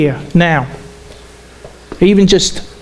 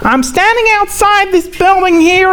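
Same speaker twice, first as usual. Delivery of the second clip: higher-pitched, hammed-up American newscaster accent; bad American accent; 12 semitones higher than usual. Imitating a US reporter on the news for the congregation, bad American accent. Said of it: "that's my very bad American accent".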